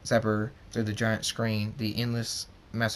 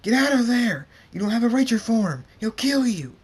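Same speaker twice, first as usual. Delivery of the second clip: high, very loud frantic yell; screamed warning; 12 semitones higher, 9 dB louder than usual. A narrator's reading. Thunderstorm warns his brother of danger, screaming. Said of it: "Thunderstorm screams".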